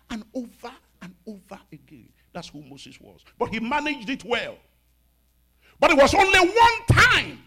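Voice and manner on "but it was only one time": high, full-force preaching shout; emphatic shout. Real, not acted. Preacher tells congregation of Moses' one failure, high emphatic shout. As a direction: cry it out to the whole church.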